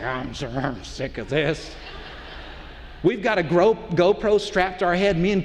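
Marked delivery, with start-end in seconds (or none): 0.0-1.7 low, slow, soft grumble; imitated fed-up wife. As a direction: imitate an unimpressed wife, dragging it out quietly. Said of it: "my wife's like".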